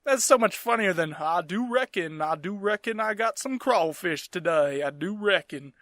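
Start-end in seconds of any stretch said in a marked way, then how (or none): 1.2-5.7 put-on slow, genteel Southern accent; old-time Southern accent. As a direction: drawl it like an old Southern gentleman. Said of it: "Just that broken, horrible fucking, uh, Southern".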